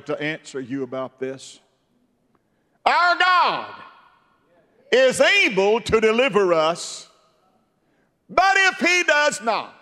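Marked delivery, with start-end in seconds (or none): 2.8-9.7 high, loud preaching defiance; loud high defiant stand; defiant Hebrew boys. Retold or imitated. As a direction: hurl it at the king. Hebrew boys defy the king.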